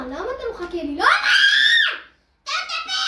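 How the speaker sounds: high, loud, laughing yell; play-angry shouting; mock angry yell. Real, not acted. Mock telling his friend to shut up, laughing.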